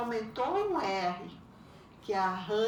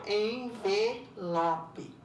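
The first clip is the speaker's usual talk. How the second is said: "envelope" slowly, syllable by syllable; split into syllables; much slower than usual. Shown as a demonstration. Teacher recapping four-syllable words, "envelope" slowly syllabified. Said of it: "que é polissílabas".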